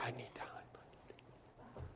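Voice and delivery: slow breathless whisper. Retold or imitated. Overwhelmed Luther pleading with the court, whispered. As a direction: barely breathe the words out. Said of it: "He says".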